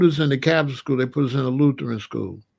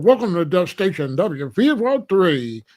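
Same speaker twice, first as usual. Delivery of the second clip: mock radio DJ station greeting; lightly hammed-up on-air host; disc jockey voice. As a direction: welcome the listeners like a radio DJ. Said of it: "He has his disc jockey voice".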